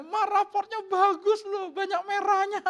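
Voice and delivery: put-on high, loud, drawn-out child's boast; proud little kid. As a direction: show off to mom in a high voice. Voices himself as proud child. (anak kecil pamer, suara melengking)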